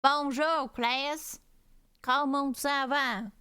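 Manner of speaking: loud, hammed-up American-accented French; cowboy French. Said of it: "We don't do that cowboy shit".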